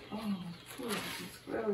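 usual speaking voice

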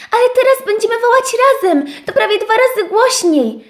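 dużo wyżej, zachęcająco; zachęć z energią, wysoko; zapał owieczki. Acted. (owieczka zachęca misia, dużo wyżej)

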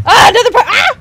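startled yelp. Real, not acted. Startled cry.

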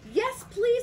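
high, loud, delighted acceptance; eager cry. A narrator's reading. Friends accept the party invitation with an eager cry. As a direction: cry out like a whole excited group. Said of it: "they all cried".

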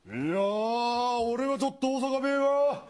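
slow, drawn-out Osaka dialect from the turtle; deadpan the Osaka dialect line; Osaka dialect punchline. Acted.

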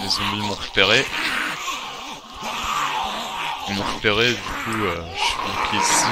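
panicking as the zombies close in; alarmed. Real, not acted. Spotted by zombies, trapped.

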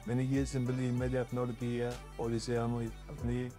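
ordinary talk

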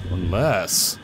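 usual speaking voice

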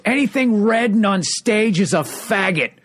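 high, slow mock heckle; heckler yelling. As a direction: drag it out like a drunk heckler. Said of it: "the guy yells out".

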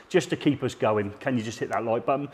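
ordinary delivery

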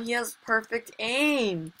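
ordinary talk, nothing marked

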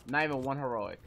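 ordinary talk

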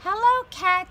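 squeaky cheery duck; duck greeting. Acted. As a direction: greet the cat cheerfully in a high voice.

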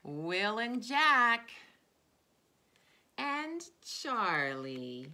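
put-on slow, drawn-out naming of each child; reading to children. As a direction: call each friend's name like a treat. Reads names of her students.

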